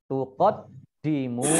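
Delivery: "tuqaddimu" with a held double d; slow "tuqaddimu", leaning on the dd; doubled dal. Shown as a demonstration. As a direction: recite "tuqaddimu", long final "muu". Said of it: "dalnya dibaca double".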